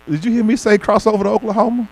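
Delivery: annoyed friend repeating herself; exasperated scolding. Retold or imitated. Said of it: "She said".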